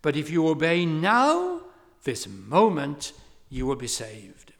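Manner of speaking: imitating a preacher's loud, high direct appeal; retold pulpit exhortation, forceful and emphatic; lay preacher. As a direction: call out the warning, then ease down at the end. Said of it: "he said".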